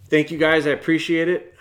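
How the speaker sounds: upbeat sign-off. Real, not acted. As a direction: sign off with a big, bright burst of gratitude. Energetic outro thanks.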